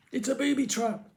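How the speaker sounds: high squealed warning. A narrator's reading. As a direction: squeal in panic. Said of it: "Nima squealed".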